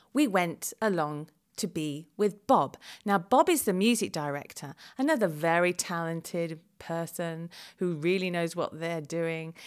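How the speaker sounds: usual speaking voice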